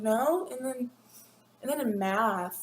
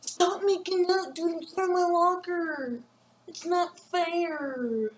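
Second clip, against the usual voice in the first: hammed-up higher yell, drawn out slowly; mock yell; 7 semitones higher, slower than usual. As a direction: yell at the couple like a whiny kid, half-joking. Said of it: "can't yell at them and be like".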